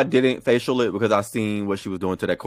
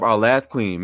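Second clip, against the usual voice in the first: normal talking voice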